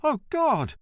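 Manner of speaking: high, startled cry; the postmaster, caught off guard, cries out; alarmed echo. Acted. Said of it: "echoed Commendatore Marzocchi".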